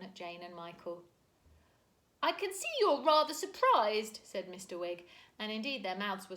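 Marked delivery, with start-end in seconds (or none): 2.2-4.2 loud, high, cheery apology; sorry but cheerful booming; apologetic jolly uncle. A narrator's reading. Mister Wig apologising to Mary Poppins, jolly.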